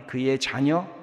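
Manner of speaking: ordinary talk, nothing marked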